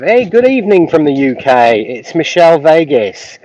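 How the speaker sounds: put-on cheesy British accent reading a chat name; mock British accent. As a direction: ham up a British greeting. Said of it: "I know the accent is borderline offensive".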